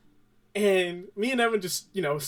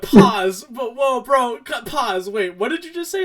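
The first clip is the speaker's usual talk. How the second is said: raised, louder mock alarm; acted incredulous interruption; 6 semitones higher, 8 dB louder than usual. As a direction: halt him in exaggerated disbelief. Retold stunned interruption.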